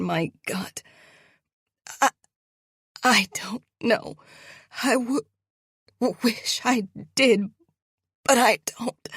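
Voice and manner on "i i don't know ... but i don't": high, loud choked stammering. Acted. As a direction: choke out the words. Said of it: "I choke out".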